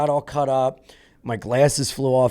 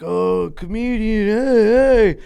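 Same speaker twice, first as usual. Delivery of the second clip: a hammed-up drunk calling to the comedian; drunk fan calling; 9 semitones higher, 4 dB louder than usual. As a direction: call out like a tipsy fan.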